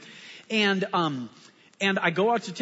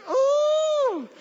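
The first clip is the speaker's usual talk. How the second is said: long, loud, very high held "oh"; high mock-outraged "ooh"; 5 dB louder than usual. Pastor after florist patted his shoulder, mock-outraged howl. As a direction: let out a sky-high, drawn-out whoop of provocation. Retold reaction to being patted.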